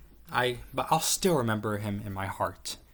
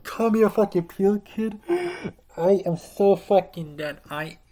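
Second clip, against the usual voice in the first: high, loud hammed-up wail; mock despair; 10 semitones higher, 8 dB louder than usual. Someone called a Peel Kid, high loud hammed-up despair. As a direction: ham up a kid crushed by the insult. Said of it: "You're like".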